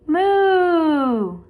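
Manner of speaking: teacher leading a loud moo; cow moo. Shown as a demonstration. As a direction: moo with the kids, big and long. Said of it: "You say it with me".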